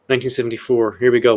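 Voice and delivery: ordinary talk, nothing marked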